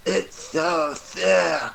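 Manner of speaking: slow, high nasal Milhouse whine; Milhouse impression. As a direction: stretch it out like Milhouse. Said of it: "Milhouse going".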